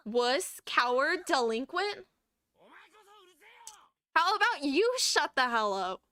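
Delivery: loud, rising string of insults; insult trade. Acted. Rival throwing insults at a classmate, angry. Characters trading insults in a fight.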